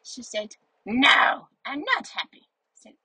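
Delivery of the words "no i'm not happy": higher, emphatic grumpy refusal; put-on grumpy protest. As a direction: act a grumpy neighbour's loud no. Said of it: "I'm very grumpy".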